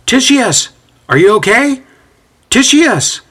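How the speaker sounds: high, urgent calling; worried, searching yell. A narrator's reading. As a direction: call out through the house, pitched right up. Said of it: "he yells out".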